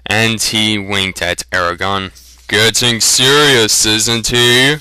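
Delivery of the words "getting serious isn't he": teasing grin. A narrator's reading. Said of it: "broad grin".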